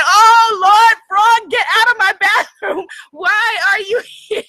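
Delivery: loud, high mock panic at the frog; half-laughing yell to shoo the frog out; panicked yell. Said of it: "I was screaming my head off".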